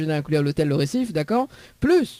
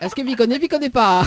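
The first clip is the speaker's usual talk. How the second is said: higher and louder, pressing for an answer; insistent repeat; 6 semitones higher, 4 dB louder, faster than usual. Real, not acted. Radio host presses the caller, higher and louder. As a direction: push the question again, teasing.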